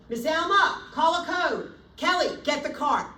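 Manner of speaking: loud, high, commanding call for help; sharp urgent shout to the team; urgent code call. Shown as a demonstration. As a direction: shout orders across the room. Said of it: "exactly how I would say it".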